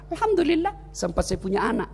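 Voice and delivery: soft, contented reply; quiet acceptance; calm, grateful. Acted. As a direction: answer the taunt gently, at peace. Ayub calmly answers the devil's taunts.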